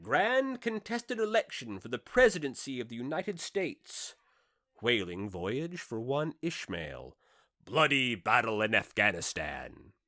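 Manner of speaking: lightly proclaimed like a grand theatre bill; a hint of a showman crying out the bill; playbill announcement. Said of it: "this part of the bill must have run something like this".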